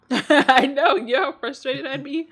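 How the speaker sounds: loud laugh through the words. Real, not acted. Laughing at solitaire fact.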